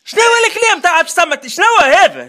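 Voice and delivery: loud, high, demanding what the word means; angry shout. Real, not acted. Man shouting at Si Mohamed over a strange word, furious.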